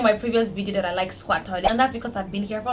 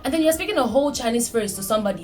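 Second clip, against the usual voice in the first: usual speaking voice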